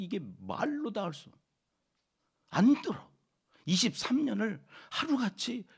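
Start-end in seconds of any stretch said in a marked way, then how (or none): 2.5-3.1 high, loud outburst; exasperated cry. Acted. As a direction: burst out in frustration. Preacher exclaims people won't listen. (답답해서 크게 외치듯)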